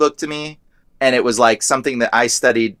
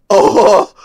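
shocked exclamation; 7 semitones higher, 6 dB louder than usual. Retold or imitated. Recalls his shock at demon's knowledge.

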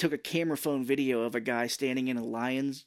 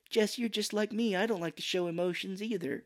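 higher, lightly mocking line reading; softened, pitched-up bad acting; terrible movie line; 7 semitones higher than usual. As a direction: imitate a wooden actress gently. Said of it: "has a terrible line, too".

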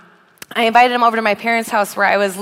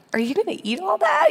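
much higher, quick teasing question; mock boyfriend question; 10 semitones higher, 3 dB quieter, faster than usual. Boyfriend asking new girlfriend about pizza, mock high voice. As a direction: squeak it out, half-joking. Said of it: "how do you ask your girlfriend".

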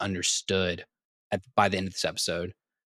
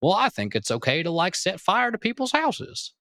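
much higher, rising put-on voice; mock naive excuser; 9 semitones higher than usual. Host mimics someone justifying setting houses on fire, mock-innocent. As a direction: climb high into a mock-innocent objection.

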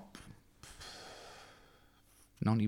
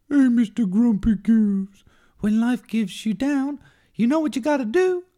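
high, loud cartoon fish voice; chirpy high cartoon fish; Dory voice; 12 semitones higher, 8 dB louder than usual. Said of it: "[name]'s movie impression".

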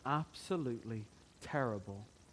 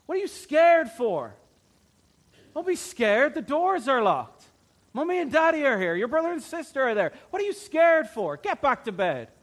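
loud, high, fast scolding yell; angry shout; 13 semitones higher, 14 dB louder than usual. Acted. Harsh parent shouting at a scared child at night. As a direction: bark it at the frightened child. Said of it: "shout at her".

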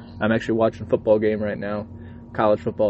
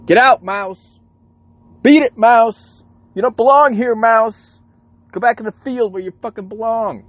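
high, loud yelling at a pest; re-enacted angry telling-off, pitched way up; cussing out; 13 semitones higher, 10 dB louder than usual. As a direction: holler to chase a mouse away. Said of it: "I'm cussing this motherfucker out".

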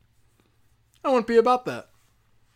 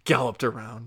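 normal talking voice

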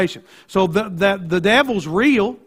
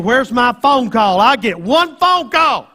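whiny complaining prisoner, slowed down; mock grumbling; 3 semitones higher, 4 dB louder, slower than usual. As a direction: grumble it like a sulking inmate. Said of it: "complaining and grumbling and saying".

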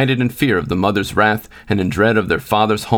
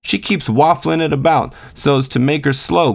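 put-on higher-pitched boyish grumble; grumbling; 6 semitones higher than usual. A narrator's reading. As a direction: grumble like a complaining little brother. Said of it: "grumbled the boy".

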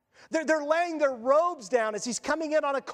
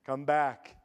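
much lower, booming call from far off; voice calling from beyond; 11 semitones lower than usual. Retold or imitated. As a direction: summon him back in a deep commanding voice. Jesus calling Lazarus from death.

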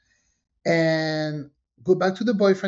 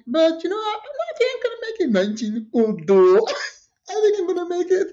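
my younger self; 12 semitones higher than usual. Retold or imitated. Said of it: "And I'm like".

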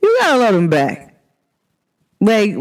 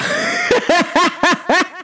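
high bursting laugh; giggling fit after the story; 12 semitones higher than usual. Real, not acted.

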